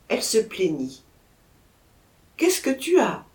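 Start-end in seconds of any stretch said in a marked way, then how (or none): 2.4-3.2 higher and louder, alarmed; husband crying out to his wife, alarmed; anxious cry. A narrator's reading. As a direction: call out to your wife in alarm. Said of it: "s'écria-t-il".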